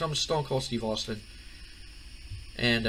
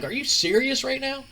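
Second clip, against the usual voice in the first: much higher, indignant woman's voice; Stephanie, indignant; 13 semitones higher, 4 dB louder than usual. Retold or imitated. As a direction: squeak it out like an offended wife. Stephanie voiced in retold scene.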